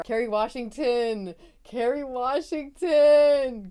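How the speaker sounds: stretched, rising cheer of her name; big adoring holler; hyped cheer. Real, not acted. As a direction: drag the name out like a fan hollering praise. Hype chant praising an actress.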